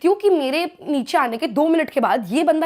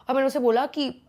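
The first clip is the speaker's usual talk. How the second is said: ordinary talk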